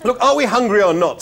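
loud, high, impatient snap; snapping. A narrator's reading. Zaphod cutting short the salad argument, snapping. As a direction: snap it at dithering companions. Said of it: "snapped Zaphod".

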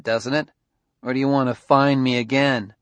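ordinary delivery, nothing marked

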